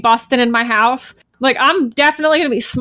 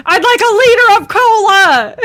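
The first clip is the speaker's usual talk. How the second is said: silly battle cry; 9 semitones higher, 5 dB louder than usual. Pretend. Silly line while attacking.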